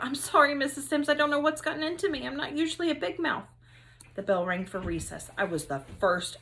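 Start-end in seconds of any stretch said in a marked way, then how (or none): 0.0-3.5 higher and rushed, louder at the start; hurried apology. Acted. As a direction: blurt the sorry all in one breath. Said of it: "I wanted to jump to my feet and call out".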